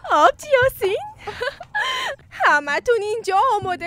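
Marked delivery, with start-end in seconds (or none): 0.1-1.0 surprised call. Acted. Surprised greeting on arrival. 1.0-2.2 high squeal of happy reunion laughter; excited high laughing cry; joyful cry of delight. Acted.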